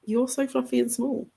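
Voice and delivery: high, soft sing-song to the cat; baby talk to a pet. Pretend. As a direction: coo at a fluffy kitten. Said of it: "And I'm like".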